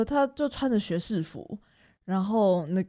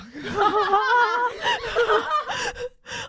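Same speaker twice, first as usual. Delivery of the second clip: 尖声爆笑，最后往下落; 忍不住笑出尖声; 高声大笑. Real, not acted. 讲到自己的糗事大笑. (尖声爆笑)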